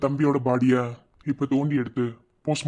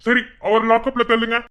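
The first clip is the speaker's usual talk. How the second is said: raised, higher-pitched officer's command; loud order; 8 semitones higher, 10 dB louder than usual. Acted. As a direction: give the order loudly. Officer orders man into lockup. (உரத்த குரலில் கட்டளை)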